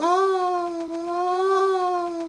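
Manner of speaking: long, high, soft fading "aah"; drifting cloud wail. Acted. Cloud carried off by the wind, high soft wail. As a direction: hold a high thin cry as if blown away.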